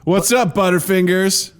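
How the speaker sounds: mock taunting call; teasing heckle.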